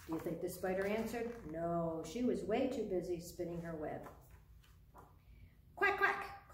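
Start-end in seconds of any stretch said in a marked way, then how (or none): 5.8-6.5 very high storybook quacks; duck quack. A narrator's reading. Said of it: "called the duck".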